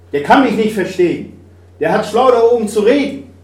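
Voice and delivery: hoch und laut, bitter; verbitterter Ausbruch. Acted. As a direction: schleudere die Anklage gegen Gott hinaus. Trauernder Vater klagt Gott an. (der Vater wirft Gott laut und bitter vor, ihn nicht zu verstehen)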